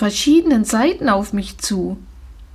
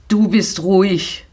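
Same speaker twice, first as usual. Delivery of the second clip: snapping at her; 3 semitones lower than usual. Acted. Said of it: "fuhr Herr Steenbergen sie an".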